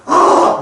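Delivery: short burst of mock screaming; acted scream. Teacher showing the delayed reaction, acted scream. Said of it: "소리 질러".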